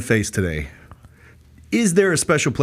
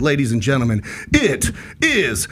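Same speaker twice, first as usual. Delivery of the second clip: ring announcer; 3 semitones higher, slower than usual. Acted. Introduces co-host hype.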